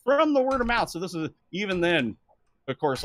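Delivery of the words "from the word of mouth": much higher, insistent; indignant proof. Retold or imitated. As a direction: push the proof at them, indignant.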